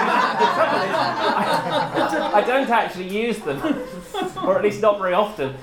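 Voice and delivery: laughing. Real, not acted. Laughing about rarely using emoji.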